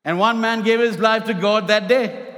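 preacher in full flow, punching out each phrase; impassioned preaching. Real, not acted. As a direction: drive the message home to a congregation. Preaches to the congregation.

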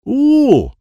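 "Ooh" for rule, R dropped, very high and loud; missing R sound. Acted. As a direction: blurt "Ooh", trying to say rule without an R. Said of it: "I wish I could say R".

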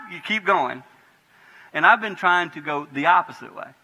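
ordinary delivery

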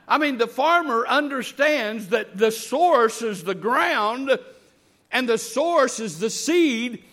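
loud, high, slow drawn-out preacher's cadence; full-force preaching. Real, not acted. Preacher to the congregation on the farmer's lesson, full-force preaching. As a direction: drive each phrase home from the pulpit.

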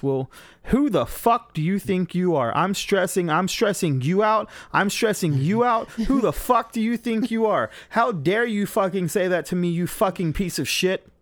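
high, loud screaming rage at him; furious yelling. Retold or imitated. Willa screaming at her boyfriend lost on the road, furious yell. Girlfriend rages at driver.

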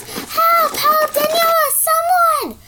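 high, loud panicked cries; screaming for help. Acted. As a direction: yell for help in fright. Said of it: "she screams".

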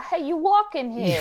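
a quick lightly voiced New York street accent; a tough guy shouting from the curb, lightly done. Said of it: "What is Boston? It's like".

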